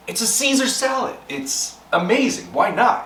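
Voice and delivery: high, eager hype; excited. Real, not acted. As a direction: sell the salad with excitement. Hyping the dish he'll make.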